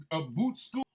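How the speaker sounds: usual speaking voice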